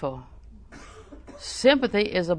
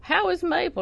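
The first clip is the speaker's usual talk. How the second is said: concerned friend asking; 11 semitones higher than usual. Retold or imitated. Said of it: "Somebody will say".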